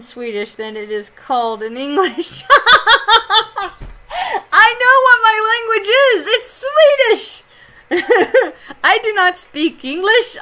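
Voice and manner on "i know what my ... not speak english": high, loud and laughing through the words; giddy laughing. Real, not acted. Streamer jokes to chat that her language is Swedish, laughing. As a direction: cackle through a silly boast.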